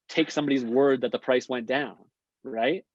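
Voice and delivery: usual speaking voice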